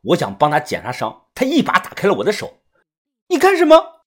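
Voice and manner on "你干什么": sharp high angry yell; angry shout. A narrator's reading. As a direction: snap at him, high and loud. Hurt girl snaps at narrator.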